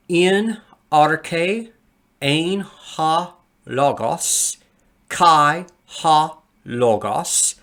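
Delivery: "In arche ein ha logos" read slowly, syllable by syllable, in Greek sounds; Greek read aloud. Shown as a demonstration. Teacher reads the Greek verse to students, slow and careful. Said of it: "take a look at the Greek equivalent".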